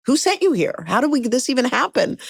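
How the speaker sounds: mock incredulous. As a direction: retell a boss's disbelieving questions. News director turns her down, retold.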